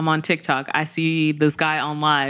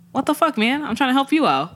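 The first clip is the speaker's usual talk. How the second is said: exasperated engineer; 8 semitones higher than usual. Retold or imitated. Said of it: "engineer's like".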